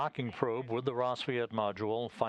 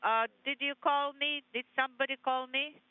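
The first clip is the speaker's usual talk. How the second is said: radio transmission. Real, not acted. Voice over the space radio loop.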